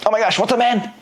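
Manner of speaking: high, overexcited gush; mock hype. Shown as a demonstration. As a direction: ham up a salesy greeting. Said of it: "what the prospects think when you're doing this".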